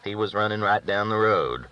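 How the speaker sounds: ordinary delivery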